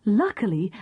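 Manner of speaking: ordinary delivery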